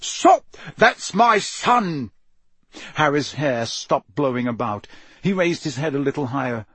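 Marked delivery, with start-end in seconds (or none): 0.0-2.1 high, loud shout, slowing at the end; desperate yell. A narrator's reading. Father halts wizards firing at his son, desperate yell. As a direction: yell to protect your child. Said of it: "yelled a voice he recognized".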